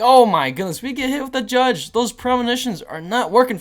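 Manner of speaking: loud, high shocked exclamation; surprised outburst. Real, not acted. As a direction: blurt it out in disbelief. Opponent plays Judge again.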